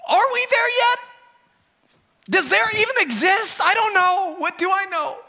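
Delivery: high, loud, exasperated whine to the Lord; frustrated whining prayer. Retold or imitated. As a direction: whine it up high like a worn-out parent. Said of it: "You're like".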